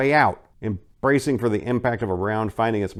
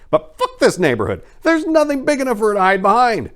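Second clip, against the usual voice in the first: high, loud, frantic exclamation; desperate outburst; 14 semitones higher, 8 dB louder than usual. A narrator's reading. Hunted woman cursing the open street, high and frantic. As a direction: panic spilling out while fleeing.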